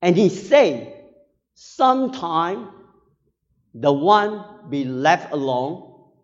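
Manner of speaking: emphatic preaching. Acted. Preacher retells a grieving pastor's testimony to the congregation, emphatic preaching. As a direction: proclaim it forcefully in measured phrases.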